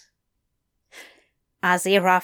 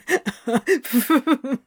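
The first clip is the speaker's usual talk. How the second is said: falling breathy laugh; helpless laughter; 3 semitones higher than usual. Acted.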